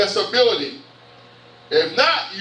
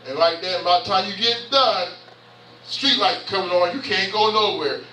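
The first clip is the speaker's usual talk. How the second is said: ordinary talk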